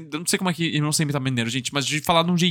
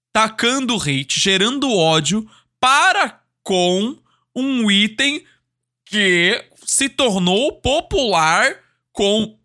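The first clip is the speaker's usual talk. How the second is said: very slow, drawn-out words with heavy pauses; pointedly slow; much slower than usual. Real, not acted. Streamer spelling out the bottle controversy, pointedly slow. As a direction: stretch each phrase deliberately.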